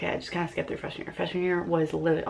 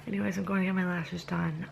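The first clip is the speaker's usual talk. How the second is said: normal talking voice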